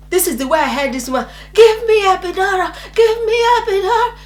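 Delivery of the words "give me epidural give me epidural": high, wailing plea; screamed demand. Retold or imitated. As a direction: beg desperately, as in labor pain. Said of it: "When I was still screaming that I needed".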